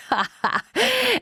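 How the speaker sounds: high, cackling laugh of a wicked sorcerer; a gloating villain's cackle; sorcerer's laugh. Acted. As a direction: cackle in triumph like an evil magician. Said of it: "Er hat gelacht".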